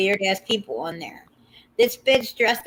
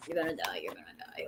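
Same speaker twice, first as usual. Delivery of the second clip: softer, whispered promise; whispered tease; 8 semitones lower, 14 dB quieter, much faster than usual. Real, not acted. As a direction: hush it like a secret. Teasing listeners before photo.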